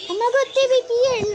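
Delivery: high, loud and very fast; excited rush. Real, not acted. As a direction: blurt it out the moment you spot them. Excited spot of enemy.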